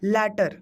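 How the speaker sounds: लैटर with an open "a" as in "cat"; open-vowel pronunciation. Shown as a demonstration. Teacher checking the contrast with students, लैटर said as "latter". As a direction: say लैटर as "LAT-ter", falling at the end. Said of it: "और इसका क्या है?".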